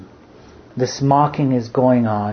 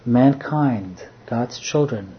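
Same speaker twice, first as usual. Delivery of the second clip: ordinary talk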